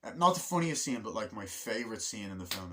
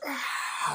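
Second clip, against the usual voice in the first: high, loud imitated shriek; mock startled scream; 5 dB louder than usual. Ferris runs out in front of the car, mock scream. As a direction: let out a cartoonish "Ah!".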